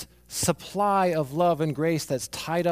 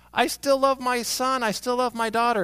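higher, louder declaration of love; insistent parent; 10 semitones higher, 5 dB louder than usual. Retold or imitated. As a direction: stand up for your child before the world. Parents defend accused child on TV.

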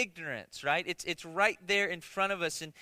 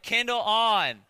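louder, carefully spoken command to a device; loud mock command; 3 semitones higher, 7 dB louder, slower than usual. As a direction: say it louder to the gadget. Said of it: "She said".

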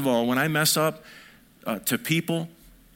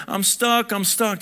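put-on stuck child; 8 semitones higher, 4 dB louder, slower than usual. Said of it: "they're like".

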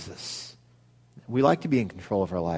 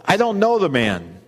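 higher, louder insistent denial sworn under oath; 6 semitones higher, 4 dB louder than usual. Acted. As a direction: insist hard that you never knew him. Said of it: "He denied it again with an oath".

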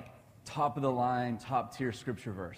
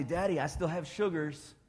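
put-on light childlike voice, higher; little son; 6 semitones higher, 3 dB louder than usual. Dad imitating Josiah asking for more tickles, lightly childlike. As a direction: play the kid asking to be tickled again. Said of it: "And Josiah will say".

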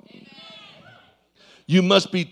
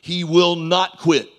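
ordinary delivery

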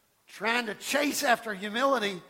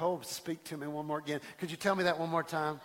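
mock eager plea; 5 semitones lower, 6 dB quieter than usual. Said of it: "the positive things you're like".

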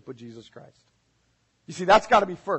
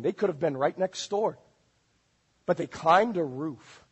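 ordinary talk

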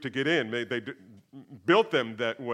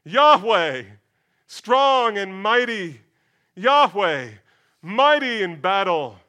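loud, higher, slow proclamation; crying back; 5 semitones higher, 9 dB louder, slower than usual. Acted. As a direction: proclaim the name back over the wall. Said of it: "cries back".

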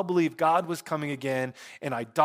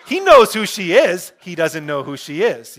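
loud, emphatic; 2 semitones higher, 12 dB louder than usual. Real, not acted. Preacher explains the movie joke over laughter, loudly emphatic.

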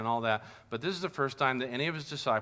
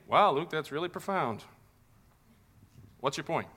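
mock sarcastic listener. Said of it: "you might be saying".

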